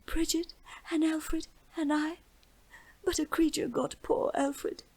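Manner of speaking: high, breathless tearful whisper; sobbing whisper. A narrator's reading. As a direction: whisper through sobs, hiccuping. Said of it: "hiccuping the story out in short fragments between sobs".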